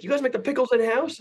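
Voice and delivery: high, baffled customer repeating himself; hammed-up customer question; mock customer voice.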